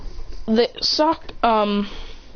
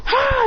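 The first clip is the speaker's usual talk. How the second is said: short high mock battle cry; attack yell. Clone about to fire, high yell. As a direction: burst of high "ah" before striking. Yell before firing the beam.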